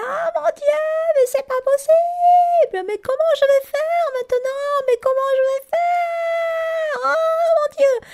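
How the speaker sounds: very high, slow, wailing scream; screaming in panic. Retold or imitated. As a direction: wail in total despair from next door. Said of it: "qui se met à hurler".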